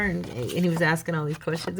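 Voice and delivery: ordinary delivery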